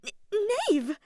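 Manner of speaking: high, loud flustered squeak; jittery, high-pitched greeting; nervous stammer. A narrator's reading. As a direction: stumble on the first sound in a fluster. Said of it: "Farina giggled nervously".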